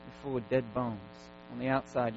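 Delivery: normal talking voice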